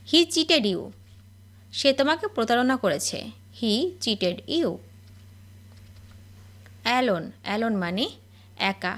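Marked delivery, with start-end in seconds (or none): 3.6-4.7 very slow, word by word; each word drawn out for learners to copy; slow dictation. Shown as a demonstration. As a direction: spell it out slowly for the class. Repeated slowly for learners.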